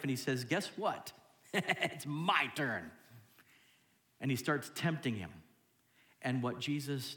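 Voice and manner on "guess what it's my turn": slow, drawn-out chuckling devil; chuckle, then relish every word as the devil; gloating devil. Acted. The devil comes to tempt Jesus at his weakest, gloating. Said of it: "when the devil comes".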